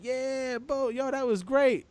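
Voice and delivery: lightly mock the fan's breezy praise; a light high put-on of the fan; imitating the fan. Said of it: "he's like".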